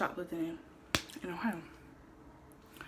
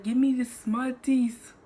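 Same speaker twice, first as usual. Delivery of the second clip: stern store owner; 6 semitones higher, 8 dB louder, slower than usual. Retold or imitated. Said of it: "He was like".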